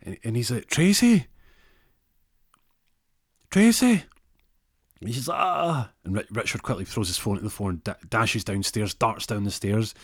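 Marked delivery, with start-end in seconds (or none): 0.6-4.0 high, loud call down the stairs; raised voice calling for his wife; acted worried calling. Calling to hurt wife downstairs. 5.4-5.9 high moaned "ah"; pained cry, put on; acted cry of pain. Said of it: "hears a grunt of pain".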